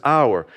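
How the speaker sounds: "our" lifted and held; stress on our. Shown as a demonstration. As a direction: point out the word "our". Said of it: "And it says".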